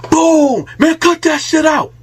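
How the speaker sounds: high, loud sudden yell; retold shout. Al yelling as he strikes, loud high shout. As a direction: bark it out like a sudden attack.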